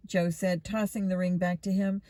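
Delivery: ordinary delivery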